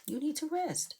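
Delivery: firm high-pitched plea to the pregnant wife; exasperated plea. Real, not acted. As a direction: insist she rest, voice leaping up. Pleading with Tiffany to rest.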